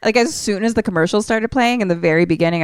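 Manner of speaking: ordinary talk, nothing marked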